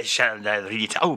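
usual speaking voice

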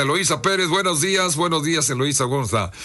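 usual speaking voice

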